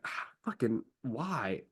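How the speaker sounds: exasperated, drawn-out inner groan; annoyed Horus. Retold or imitated. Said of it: "Horus is just so annoyed".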